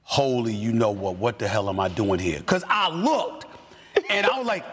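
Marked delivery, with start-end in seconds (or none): 0.0-2.4 much lower voice, as if talking to yourself; re-enacted inner panic, kept low; stunned self-questioning. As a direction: drop low, thinking aloud in dismay. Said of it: "I literally went like this".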